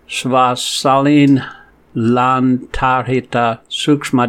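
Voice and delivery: measured devotional recitation; unhurried scripture chanting; slow Sanskrit verse recitation. Acted. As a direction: chant the verse slowly and evenly.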